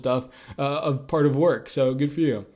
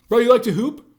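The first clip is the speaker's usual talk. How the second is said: excited; 9 semitones higher, 6 dB louder than usual. Acted. Eagerly turning toward friend's bid.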